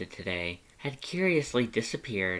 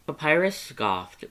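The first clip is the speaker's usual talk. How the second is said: plain reading voice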